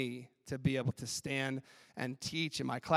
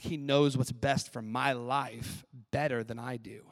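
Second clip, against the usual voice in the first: usual speaking voice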